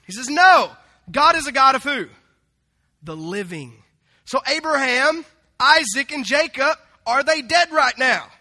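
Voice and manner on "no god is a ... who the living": loud, raised-pitch rebuttal; acting out a teacher's forceful challenge; emphatic correction. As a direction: burst in with a booming "No". Acts Jesus correcting them forcefully.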